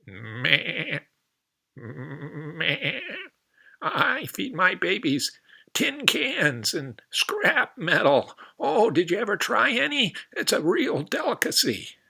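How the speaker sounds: high, bleating nanny goat, slow at first; a proud goat bragging about her odd food. Acted. Goat mother boasting to the frog, high bleating voice.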